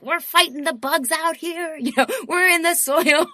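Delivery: high, loud, laughing mock-tough voice of scrappy organic vegetables; voice of organic food. Organic food bragging it fights bugs, high laughing mock-tough voice. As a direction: play the gritty organic veggie bragging about fighting bugs.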